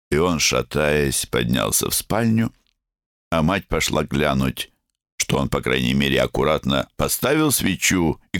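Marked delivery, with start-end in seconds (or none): none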